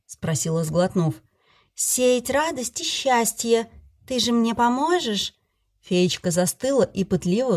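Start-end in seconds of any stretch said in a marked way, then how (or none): 1.7-5.3 high, slow, giddy fairy; tipsy fairy voice. Acted. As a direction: play the drunk fairy, elated. Fairy character voice.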